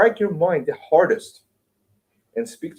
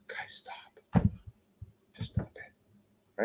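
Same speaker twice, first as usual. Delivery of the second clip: very soft, whispered appeal to stop; hushed weary plea; 11 dB quieter, slower than usual. Acted. Jesus telling us to stop, hushed acted plea. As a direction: drop to a near whisper, slowing down.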